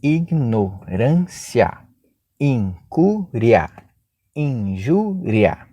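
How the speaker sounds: drawn out, each syllable spaced; slow syllable by syllable. Shown as a demonstration. Teacher slowly dividing the words into syllables. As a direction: spell them out slowly for the class. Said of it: "vamos fazer a divisão silábica".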